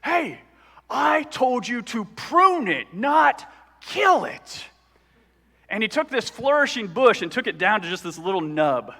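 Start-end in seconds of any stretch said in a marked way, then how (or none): 0.0-4.6 high, slow shouted scolding; retold scream at son. Dad yelling at his son over the bush, slow high shout. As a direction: yell it like an angry dad. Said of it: "he is just screaming at his son".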